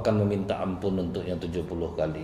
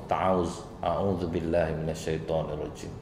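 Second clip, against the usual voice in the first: soft recitation; 4 semitones lower, 6 dB quieter than usual. Shown as a demonstration. Recites the refuge formula.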